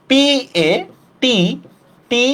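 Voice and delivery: letter by letter, পি এ টি টি with gaps between; spelling out. Shown as a demonstration. Teacher writing a pronunciation for students, spelling out slowly. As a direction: spell পি এ টি টি one letter at a time. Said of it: "পাঠ্য শব্দের উচ্চারণটা লিখি".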